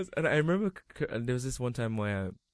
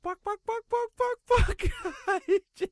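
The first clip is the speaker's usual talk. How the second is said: high squawky chicken bawks, much higher and louder; chicken clucking; 8 dB louder, much slower than usual. Retold or imitated. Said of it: "I'm going to do this embarrassing thing".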